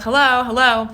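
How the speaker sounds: loud calls of hello into the flat; yelling hello. Retold or imitated. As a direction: shout hello to find the intruders. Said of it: "So I start yelling".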